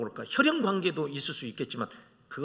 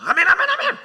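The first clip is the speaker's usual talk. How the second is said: quick high loud amens; imitating Jeolla congregants' instant amens; rapid eager amens; 10 dB louder than usual. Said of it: "전라도 가서 아멘 시키면은".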